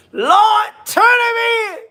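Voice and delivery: high crying shout, imitating the aunt; mock wailing plea. Host mimics the aunt's wailing plea to the police. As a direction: wail it like the grieving aunt. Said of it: "why did the auntie say".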